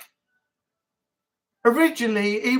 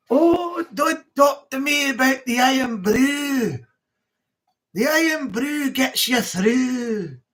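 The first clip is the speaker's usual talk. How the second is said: put-on Scottish accent; slower than usual. Irn-Bru ad, Scottish voice.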